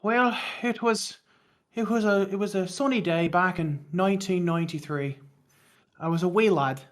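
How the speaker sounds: hammy storybook Irish lilt; Irish accent. Pretend. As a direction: put on a bad Irish brogue. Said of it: "that poorly, uh, uh, with that poor, uh, Irish accent".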